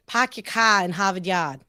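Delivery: Boston accent with dropped R's; non-rhotic Massachusetts accent. Shown as a demonstration. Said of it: "how you say it is".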